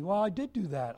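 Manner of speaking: ordinary talk, nothing marked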